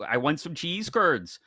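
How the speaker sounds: a light upper Midwest accent demo. Shown as a demonstration. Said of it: "you talk like this".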